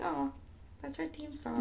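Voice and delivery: high, soft little gasp of recognition; excited. Retold or imitated. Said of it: "I got really excited".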